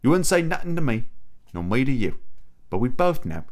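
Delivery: put-on hammy, thick British film-butler accent; Michael Caine. Confessing to Bruce Wayne about a cafe vision, terrible thick British accent. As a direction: deliver a wistful confession in a badly done British accent. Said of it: "my terrible Michael Caine impression".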